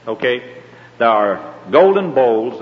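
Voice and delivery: ordinary talk, nothing marked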